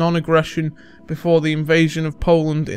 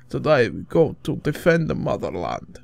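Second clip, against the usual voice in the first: bad Russian accent. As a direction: put on a terrible Russian accent. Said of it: "worst Russian accent you'll ever hear".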